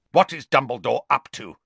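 brusque. Acted. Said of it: "said Scrimger brusquely".

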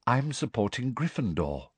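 soft, dreamy announcement; hushed, dreamy girl's voice. Acted. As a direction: state it vaguely and airily. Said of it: "said Luna".

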